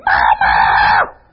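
long, harsh scream of "Mama"; child's alarmed scream. Acted. Child screams to parent from another room: alarmed scream. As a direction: scream out for mother with everything you have. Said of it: "you hear something".

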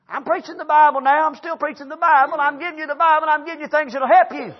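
high, insistent pulpit cadence; preaching. Real, not acted. Preacher defending his stories to congregation, insistent preaching.

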